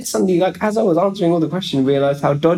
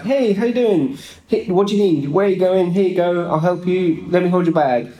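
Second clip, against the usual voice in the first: higher, chirpy imitation of an eager helper; helpful station lady; 3 semitones higher than usual. Canadian lady helping a traveller at the station, eagerly helpful. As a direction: offer help eagerly, like a friendly local. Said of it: "a really helpful lady at the train station".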